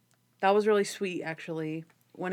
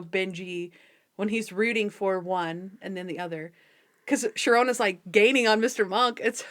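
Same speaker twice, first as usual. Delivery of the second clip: ordinary talk, nothing marked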